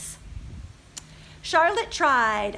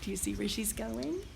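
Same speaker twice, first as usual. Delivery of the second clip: soft, low conspiratorial question; hushed aside; 4 semitones lower, 9 dB quieter than usual. Real, not acted. Librarian asking the children, hushed and low. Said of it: "aside to kids".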